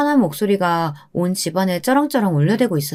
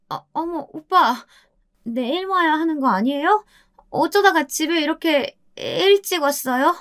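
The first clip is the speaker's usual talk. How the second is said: startled wife; 9 semitones higher than usual. Acted. Wife caught by husband.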